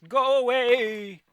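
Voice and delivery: slow, high, loud scared yell; frightened wizard shout. Acted. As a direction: cry it out in panic to scare him off.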